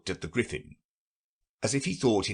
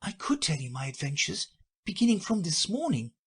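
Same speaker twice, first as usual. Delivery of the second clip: a little timid; 9 semitones higher than usual. A narrator's reading. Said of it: "said Alice a little timidly".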